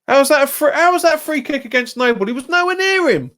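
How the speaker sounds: high, loud disbelieving outcry at the referee; outraged protest. Real, not acted. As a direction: shout your disbelief at a terrible call. Outraged at a foul call.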